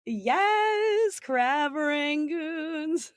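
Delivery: high, drawn-out gleeful cry; gleeful exclamation. Real, not acted. Guest owning up to the viral crab rangoons, gleeful. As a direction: stretch it out high with delight.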